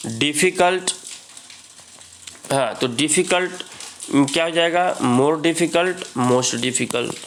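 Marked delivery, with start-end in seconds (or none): none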